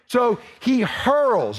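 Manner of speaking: slow, weighty "hurls" falling at the end; stress on hurls. Shown as a demonstration. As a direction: let "hurls" land like a thrown weight. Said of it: "Notice the word hurl".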